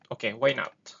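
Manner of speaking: ordinary talk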